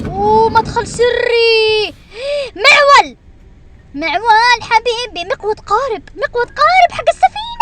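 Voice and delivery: high, loud, drawn-out excited cries; excited discovery. Real, not acted. Gamer discovers a secret entrance and tools, high excited cries. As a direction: gasp at each new find.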